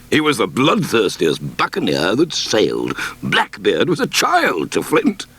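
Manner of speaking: lightly voiced gruff squire, excited about a pirate; a hint of a rough old squire telling a pirate tale; bluff squire. Squire's voice in story.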